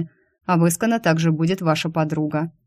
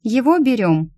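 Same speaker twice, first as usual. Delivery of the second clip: higher, raised-voice question; louder; 6 semitones higher, 3 dB louder than usual. A narrator's reading. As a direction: ask it up and out loud. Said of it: "уже громче добавила". (громче, с вопросом вверх)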